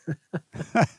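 a short amused laugh at his own story; quick laugh after the punchline; real laugh. Real, not acted. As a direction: laugh it off lightly.

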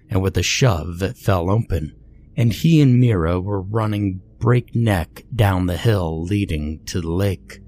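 plain reading voice

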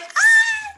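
high, loud fangirl squeal; delighted squeal. Retold or imitated. Reader reacting to the love confession, delighted squeal. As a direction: let out a piercing squeal of delight.

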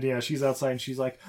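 ordinary talk, nothing marked

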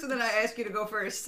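ordinary talk, nothing marked

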